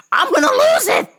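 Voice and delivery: hammed-up breaking point; mock outburst. Threatening to lose it.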